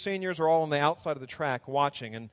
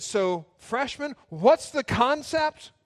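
slow, much higher and loud challenge; stern demand; 9 semitones higher, 5 dB louder, slower than usual. Retold or imitated. Director challenging freshmen, slow loud demand. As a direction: stare them down and demand it. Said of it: "staring at the freshmen".

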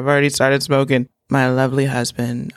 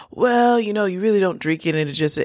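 mock husband; 7 semitones higher than usual. Mocking husband's excuse.

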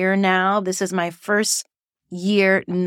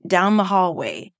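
ordinary delivery

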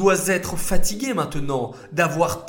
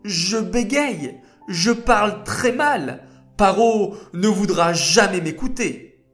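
arguing anxiously; 6 semitones higher, 5 dB louder than usual. Acted. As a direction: argue with worried insistence. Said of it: "argumenta Moshe". (Moshe argumente, inquiet et suppliant)

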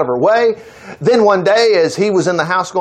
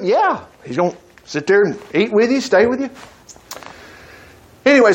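ordinary talk

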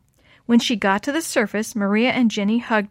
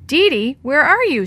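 raised, higher searching call; yell; 5 semitones higher, 6 dB louder than usual. A narrator's reading. Sammy yells for a missing friend in the backyard, calling out. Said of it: "Sammy yelled".

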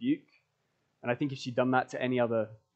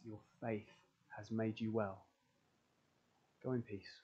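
tender blessing; 8 dB quieter than usual. Acted. Jesus speaks to believers.

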